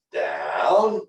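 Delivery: Down. with the voice going up at the end; rising command. Shown as a demonstration. Trainer showing the owner the rising command. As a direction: lift the pitch at the end of Down. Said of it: "you hear how my voice goes up at the end".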